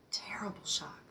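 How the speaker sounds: soft, half-whispered echo of the shock; low, hushed, voice nearly gone; hushed grief. Real, not acted. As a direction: let it fade almost to a whisper. Recalling news of son's death.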